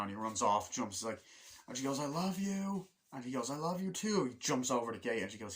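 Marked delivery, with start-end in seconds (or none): 2.0-2.9 high, drawn-out mock "lovey" voice; lovestruck call. Girl to departing boyfriend in film retelling, mock lovestruck. Acting out lovers calling goodbye. 3.5-4.3 lovestruck reply. Retold or imitated. Boy answers girlfriend as he leaves, mock lovestruck. Acting out lovers calling goodbye.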